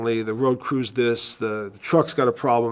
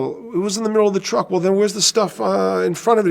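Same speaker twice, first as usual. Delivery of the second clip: quoted exchange; 8 semitones higher, 3 dB louder than usual. Retold or imitated. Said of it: "phone dialogue continues".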